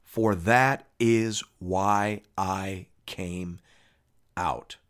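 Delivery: very slow, measured words, dropping at the end; deliberate, low-ending scripture quote; solemn declaration. A narrator's reading. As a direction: weigh each word of a stated purpose. Jesus states purpose, voiced in reading.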